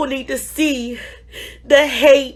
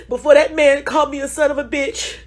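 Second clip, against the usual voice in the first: ordinary talk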